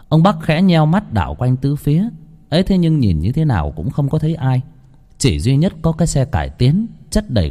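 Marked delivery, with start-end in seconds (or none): none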